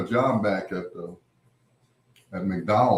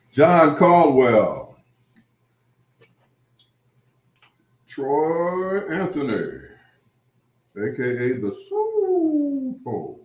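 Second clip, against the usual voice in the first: slow, drawn-out hype announcement, louder; announcer intro; 4 semitones higher, 5 dB louder, much slower than usual. Pretend. Host introducing guests, a slow ring-announcer call.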